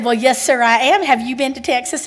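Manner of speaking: put-on Southern. Shown as a demonstration. To the Texas-loving gentleman, lightly Southern. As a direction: lay on the Southern charm. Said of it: "I can put on the Southern".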